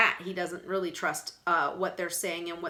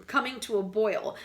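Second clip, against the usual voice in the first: usual speaking voice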